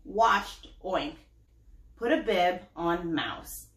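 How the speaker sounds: put-on lively storybook reading for kids; story narration. A narrator's reading. Mom reading a picture book to her child, animated. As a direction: read it out like bedtime to little ones.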